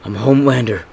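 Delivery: lightly acted disbelieving protest; mildly voiced defiant plea; Homelander. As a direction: protest as if you can't be beaten. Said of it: "Homelander starts saying".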